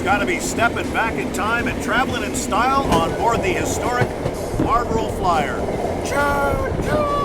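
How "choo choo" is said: much higher playful choo choo; rising playful steam train call; train whistle imitation.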